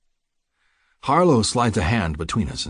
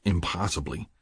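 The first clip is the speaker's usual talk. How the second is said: ordinary delivery, nothing marked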